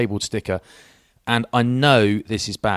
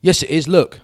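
louder, higher insisting; insistent stranger; 8 semitones higher, 7 dB louder than usual. Retold or imitated. Stranger at Morrison's insisting on the parking, louder and higher. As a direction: push back hard and point. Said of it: "It's like".

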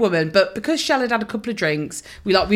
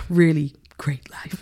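whispered wistful line, imitating; breathy whisper; 4 semitones lower, slower than usual. [name] retelling Lulu's onstage chat in a whisper. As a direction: hush it out, sighing. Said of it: "so she was like".

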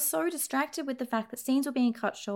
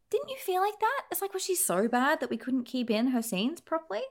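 usual speaking voice

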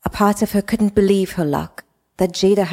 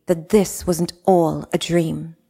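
ordinary delivery

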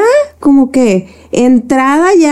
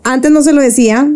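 ordinary talk, nothing marked

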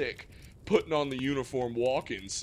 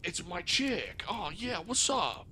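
higher, dopey mock of Scooter; mock dumb guy; 4 semitones higher, 4 dB louder than usual. Mocking Scooter's flimsy reason to return, dopey. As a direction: play Scooter as an eager airhead, higher.

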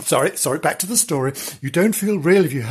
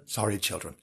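hushed, sheepish sorry; soft repeated apology; 5 semitones lower, 11 dB quieter, faster than usual. Acted. Storyteller apologising to children, hushed and sheepish. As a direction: drop right down, a little embarrassed.

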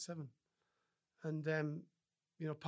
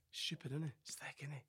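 mocking whisper; 8 dB quieter than usual. People gossiping about the boy who can't read, in a sneering whisper. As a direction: murmur the insult behind his back.

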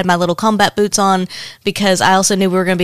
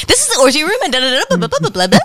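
higher, hurried tour patter; rushed; 7 semitones higher than usual. Retold or imitated. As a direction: rattle it off fast. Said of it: "that's actually not how you said it".

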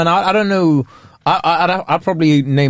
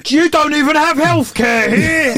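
high, drawn-out mock holler; mock club shouting; 11 semitones higher, slower than usual. Brit in American club hollers at a girl, mock shouting. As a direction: pitch it way up and stretch it out.

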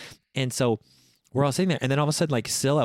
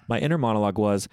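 normal talking voice

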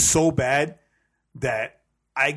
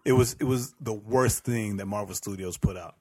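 ordinary talk, nothing marked

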